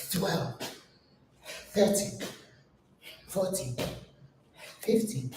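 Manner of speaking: soft, breathy rep count; counting under breath. Real, not acted. Trainer counting her reps softly during the exercise. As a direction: count the reps quietly while working.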